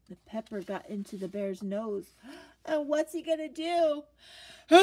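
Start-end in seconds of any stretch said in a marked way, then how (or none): 4.7-4.8 a hammed-up sneeze; big sneeze. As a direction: sneeze it out for the kids. Bear sneezes in story.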